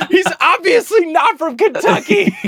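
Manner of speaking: high-pitched laughing shriek; incredulous squealed protest; exasperated yell through laughter. Real, not acted. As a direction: yell the obvious while cracking up. Exasperated at repeated corrections.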